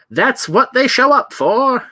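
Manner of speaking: put-on old newsreel narrator; old-timey voice. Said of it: "and my old-timey voice".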